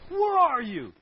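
loud, high accusing shout; angry God. Acted. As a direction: bark it like an angry judge. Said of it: "you can hear".

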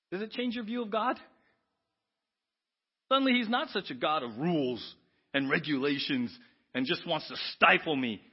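ordinary talk

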